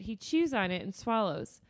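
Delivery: ordinary talk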